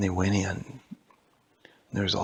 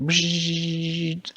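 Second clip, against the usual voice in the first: higher, far-off electric bzzzt; buzzing noise; 7 semitones higher than usual. Acted. Elvie's face glitches, electric buzzing sound. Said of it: "his face went".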